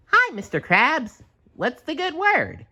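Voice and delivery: high cheerful cartoon sponge voice; SpongeBob voice. A narrator's reading. SpongeBob greeting his boss, high squeaky voice. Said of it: "SpongeBob asked".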